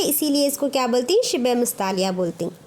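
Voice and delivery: normal talking voice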